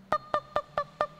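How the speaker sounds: high, light tok tok knocks; knocking sound. Acted. Storyteller to children, a high playful knocking sound. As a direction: tap out the knock with your voice.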